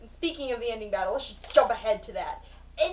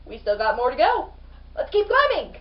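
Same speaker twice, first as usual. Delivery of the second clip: excited call; 9 dB louder than usual. Retold or imitated. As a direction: urge your friends upward with energy. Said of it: "you're like".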